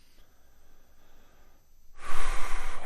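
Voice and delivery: long, loud breath out; big releasing sigh; deep exhaling sigh. Shown as a demonstration. Meditation leader showing a deep breath, long audible exhale.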